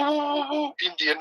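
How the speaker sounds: ordinary talk, nothing marked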